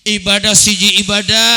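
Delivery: loud, high call-and-response lead; rallying preacher. Real, not acted. Preacher rallying Mangunjaya villagers to pray together, high loud rallying call. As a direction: belt the slogan out for the crowd to answer.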